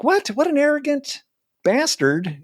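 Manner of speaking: high-pitched, indignant mock outrage; pissed off. Young comic retelling reaction to a famous comedian's brush-off, pissed off. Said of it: "it pissed me off".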